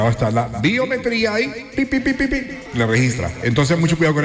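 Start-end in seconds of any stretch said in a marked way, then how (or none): 1.8-2.3 imitated beeping. Imitating the biometric scanner beep.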